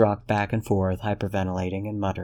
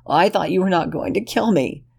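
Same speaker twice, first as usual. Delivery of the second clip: put-on high, pleading young man's voice; higher and louder, pleading with Ren; desperate Lexington; 11 semitones higher, 4 dB louder than usual. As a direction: beg him to understand, voice pitched up. Lexington wounded.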